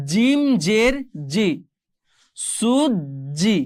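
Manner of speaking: "জিম জের জি" slowly, then "সুজি" as a long suj-ji; spelling drill. Shown as a demonstration. Teacher joins the syllables for learners, very slow "সুজি". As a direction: draw out "সুজি" with a held double j. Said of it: "আমরা বাকিগুলো একটু পড়ার চেষ্টা করি".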